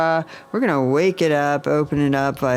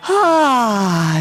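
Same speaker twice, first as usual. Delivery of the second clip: high, louder open ah; sung sigh; 10 semitones higher, 5 dB louder than usual. Shown as a demonstration. Teacher showing the heart chakra sigh. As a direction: release a big ringing ah. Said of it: "Ah is the exercise for it".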